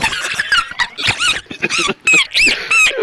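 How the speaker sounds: long high-pitched squealing laugh; helpless laughter. Real, not acted. Friend cracking up at own dry window joke, helpless laughter. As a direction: crack up in a high, drawn-out cackle.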